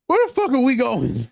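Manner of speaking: high-pitched mock alarm; alarmed passenger. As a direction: imitate a passenger demanding answers. Retelling the worried passenger's question.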